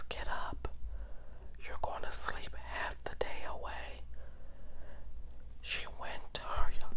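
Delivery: usual speaking voice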